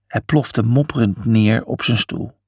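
plain reading voice

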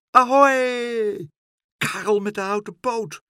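high, loud drawn-out "ahoy" hail; bird's high, ringing call. Acted. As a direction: hail someone from up in the air. Gull calls from above.

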